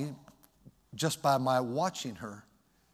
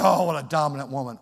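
mock protest; 9 dB louder, faster than usual. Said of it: "I said".

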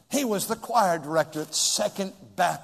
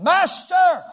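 loud, much higher call of Master; frantic cry; 13 semitones higher, 10 dB louder than usual. Retold or imitated. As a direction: wake him in a panic. Reading the disciples crying out.

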